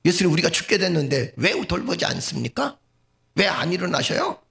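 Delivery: high-pitched, reproachful questioning; acted complaint. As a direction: demand to know why, as a panicked disciple. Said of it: "이렇게 얘기하는 거하고". (따지듯 원망하는 말투)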